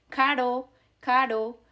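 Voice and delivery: Caro with a single tap; tapped R. Shown as a demonstration. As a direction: flick the r in Caro. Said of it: "so you call them".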